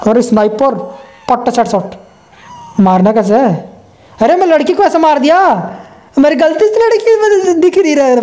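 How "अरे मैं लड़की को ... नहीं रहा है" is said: shocked outburst. Real, not acted. Gamer to friends after shooting the girl by mistake, alarmed high outburst. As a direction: pitch jumps way up in alarm at his own mistake.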